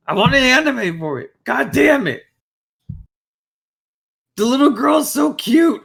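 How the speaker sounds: high, squealing, excited pitch; gushing fangirl. Real, not acted. Fan begging for an anime of a manga they love, high excited gush. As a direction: let the passion burst out in a high squeal. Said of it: "I'm very passionate".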